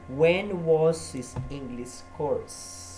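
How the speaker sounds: very slow, every word laid out; teacher modelling slowly; slow model question. Shown as a demonstration.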